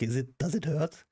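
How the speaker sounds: a hint of a smug doctor asking innocently; mock doctor. Doctor asks patient mid-injection, lightly mocked. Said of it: "And he goes, like".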